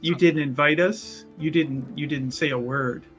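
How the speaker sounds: ordinary talk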